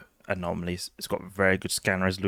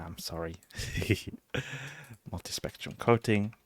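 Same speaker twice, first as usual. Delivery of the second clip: ordinary talk, nothing marked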